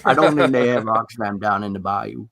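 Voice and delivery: a lightly put-on Louisiana accent. Tom joking about the bayou in a Louisiana accent. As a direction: play a Cajun from the bayou. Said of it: "that sounds very Louisiana".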